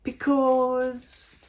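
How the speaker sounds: put-on very high, loud showy child voice; a little girl showing off her dance; twirling, boastful child voice; proud little ballerina. A narrator's reading.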